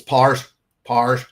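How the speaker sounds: Powers in broad Northern Irish, squashed to "Parrs"; thick Northern Irish "Powers", sounding like "Parrs"; own Northern Irish accent. Shown as a demonstration. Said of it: "Honestly, in my own accent".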